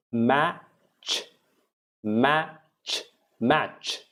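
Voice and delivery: isolated words with an open short A, as in sat; open A drill. Shown as a demonstration. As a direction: model sat, hand, match, mouth wide on the vowel. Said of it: "open your mouth a little more".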